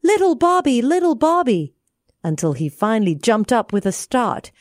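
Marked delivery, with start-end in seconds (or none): 0.0-1.7 high calling from afar. Acted. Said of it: "hear people call".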